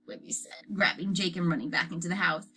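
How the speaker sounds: usual speaking voice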